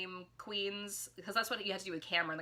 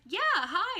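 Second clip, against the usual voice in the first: high, louder bubbly greeting; sweet drag queen; 13 semitones higher, 7 dB louder than usual. Retold or imitated. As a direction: play the queen as sweet and chirpy.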